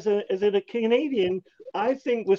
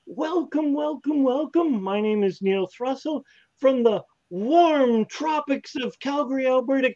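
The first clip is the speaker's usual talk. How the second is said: ordinary talk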